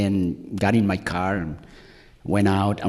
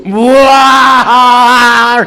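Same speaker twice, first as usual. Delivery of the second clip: long, high, full-volume bawl; belted mock wail; 11 dB louder than usual. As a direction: throw out a huge imitated crying howl. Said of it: "I belted that cry".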